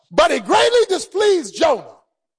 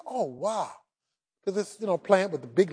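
contented sigh; 7 semitones lower, 8 dB quieter than usual. Acted. Jonah relaxing under plant.

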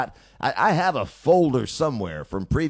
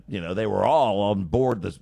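ordinary talk

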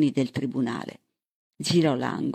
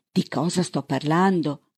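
plain reading voice

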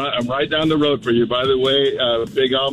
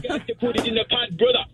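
high, softer Jamaican cook; Jamaican accent; 11 semitones higher, 3 dB quieter than usual. Shown as a demonstration. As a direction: Jamaican cooking-show host, voice pitched right up. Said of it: "like, a Jamaican accent".

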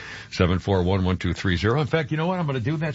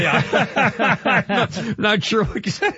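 high, helpless laughing speech; real giggles through the words; 8 semitones higher, much slower than usual. Real, not acted. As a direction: try to talk while cracking up. Cracking up on air.

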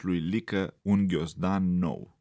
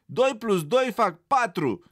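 loud, much higher cry; defiant shout; 11 semitones higher, 5 dB louder than usual. A narrator's reading. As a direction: shout the right answer at last. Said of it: "țipă Lică".

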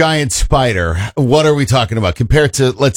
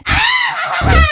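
laughing shout. Real, not acted. Laughs at witch voice.

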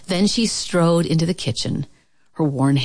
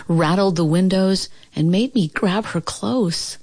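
plain reading voice

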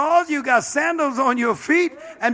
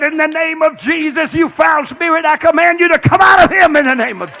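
loud, high rebuke of a spirit; preacher shouting down a foul spirit; commanding shout; 5 semitones higher, 8 dB louder than usual. Retold or imitated. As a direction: cast it out at full voice. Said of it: "I said".

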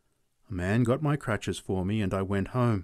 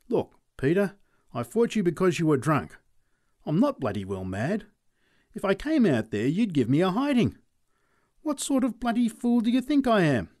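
high, loud panicky refusal; scared neighbour; 8 semitones higher, 4 dB louder than usual. Acted. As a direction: back out in a fluster. Charlie reasons, scared.

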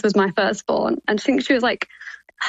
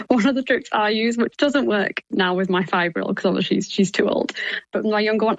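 ordinary delivery

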